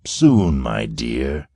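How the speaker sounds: calm her down with a smirk; ironic soothing. A narrator's reading. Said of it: "Quarren soothed ironically".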